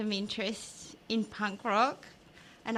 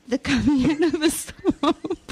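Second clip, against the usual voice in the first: laughing; 2 semitones higher, 6 dB louder than usual. Real, not acted. Laughing at the swamp description.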